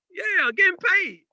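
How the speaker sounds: put-on boy answering the preacher, high and drawn out; eager kid answer. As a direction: say it like an eager kid who only wants the money. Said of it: "I'm like".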